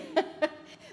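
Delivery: airy breathy giggle. Real, not acted. Laughing at own joke, airy giggle. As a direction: let a light giggle escape.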